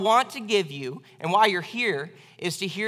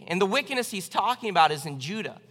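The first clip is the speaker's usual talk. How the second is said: ordinary talk, nothing marked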